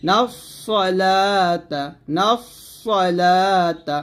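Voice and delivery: "নাফসালাতা" slow, with long thick s and held "লা"; heavy Sad. Shown as a demonstration. Teacher repeating for learners, slow heavy-s "নাফসালাতা". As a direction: hiss into the emphatic s of "নাফসালাতা", stretch "লা". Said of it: "সদ মোটা হবে".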